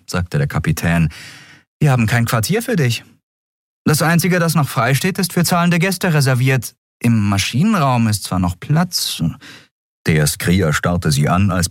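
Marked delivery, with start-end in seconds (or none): 1.8-9.2 lightly voiced captain, high; young captain. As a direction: break it to him gently. (Kapitänin, sachlich)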